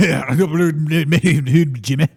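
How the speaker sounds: loud, garbled caricature Scot; mock Scottish accent. Shown as a demonstration. Agreeing about burritos with Jimmy, garbled mock Scottish accent. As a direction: slur the words in exaggerated Scottish. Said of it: "what a Scottish accent would sound like".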